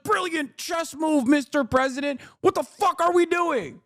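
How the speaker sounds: high voice, sarcastic praise then panic; hammed-up fawning crowd; mock sycophants turning desperate. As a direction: gush then cry out, high. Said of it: "everyone's like".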